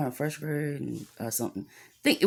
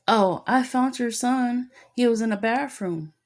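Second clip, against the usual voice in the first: imitated teacher; 8 semitones higher than usual. Teacher calling parent about the found son, mimicked.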